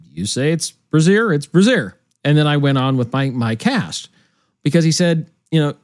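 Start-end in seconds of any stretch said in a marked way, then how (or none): 0.1-2.0 brazier as "bruh-ZEER", both times; mispronounced brazier. Retold or imitated. Deferring to the boss on air, brazier mispronounced "bruh-ZEER". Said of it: "if they tell you that brazier is brazier".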